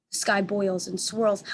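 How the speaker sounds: plain reading voice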